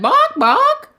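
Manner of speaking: very high, loud yapping with a laugh; yappy bark. Acted. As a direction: yap like a tiny dog, giggling. Remembered bark.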